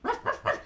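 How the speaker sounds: high breathy ruffs; dog bark. Shown as a demonstration. Inviting the kids to bark along like a dog. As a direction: yap like a little dog. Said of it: "Can you try that?".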